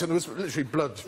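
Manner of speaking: ordinary delivery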